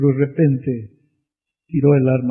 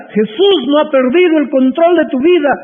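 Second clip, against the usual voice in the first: shouted much higher and louder; triumphant preaching cry; 13 semitones higher, 8 dB louder than usual. Acted. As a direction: proclaim it with full force to the hall. Same line repeated, quiet then loud.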